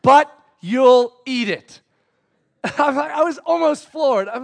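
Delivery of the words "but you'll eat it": loud, slow, spaced-out words; repeated toddler protest. Retold or imitated. Preacher repeating his son's complaint, loud slow mock protest. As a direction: hammer out each word in mock accusation.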